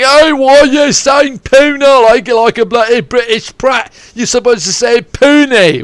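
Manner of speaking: high, loud, slow indignant commenter voice; mocking online commenter. Imitating a commenter scolding his pronunciation, high and loud. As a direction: sneer it like a fussy troll correcting you. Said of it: "comments on me saying".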